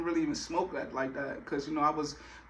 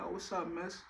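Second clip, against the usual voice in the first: playing it cool; 11 dB quieter than usual. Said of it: "So I played it cool".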